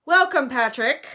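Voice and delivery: stiff. A narrator's reading. Nick receiving his rival Patrick, stiff. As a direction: greet him with rigid politeness. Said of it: "said Nick stiffly".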